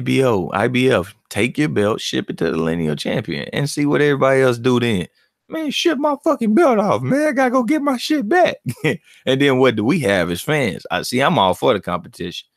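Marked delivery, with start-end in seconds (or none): 5.5-8.6 high, squeaky mock protest; mock angry boxer. Imitating a fighter whining about his belt. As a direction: whine like a champ stripped of his belt.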